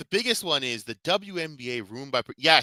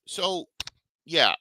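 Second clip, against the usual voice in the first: ordinary delivery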